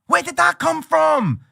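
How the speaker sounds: high, loud mock astonishment; astonished. Trader seeing a huge Forex spike, high loud surprise. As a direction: gasp at the sudden move. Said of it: "and you're like".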